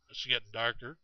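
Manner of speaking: ordinary delivery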